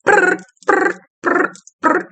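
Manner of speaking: high, loud brr bursts; buzzing trill. Retold or imitated. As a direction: imitate frantic energy with a rolled brr. Imitates a restless child's buzzing energy.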